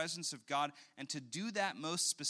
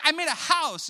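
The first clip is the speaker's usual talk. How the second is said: much higher and louder, squealing with pride; proud toddler; 13 semitones higher, 14 dB louder than usual. Retold or imitated. Father voicing his daughter bragging about her board. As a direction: squeal like a delighted little girl. Child answers the strangers.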